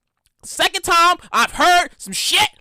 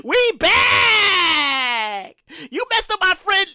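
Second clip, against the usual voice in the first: returning aliens; 3 semitones higher, much slower than usual. Pretend. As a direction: call out like E T's gang coming back. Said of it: "E T and friends to be like".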